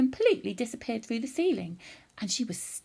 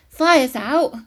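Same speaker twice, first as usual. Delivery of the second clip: loud, slightly raised, mildly proud; proud announcement; 4 semitones higher, 11 dB louder than usual. A narrator's reading. George telling grandma he doused the fire, mildly proud. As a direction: announce it with a touch of pride. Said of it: "George announced proudly".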